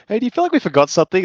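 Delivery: quick, high, loud mock dialogue; retold character line. Retelling the scene for laughs, quick high voice.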